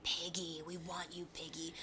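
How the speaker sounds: creepy whispered call from the dark; very soft, ghostly mock taunt; eerie taunting voice. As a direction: whisper it to spook someone. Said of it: "you hear a fucking voice be like".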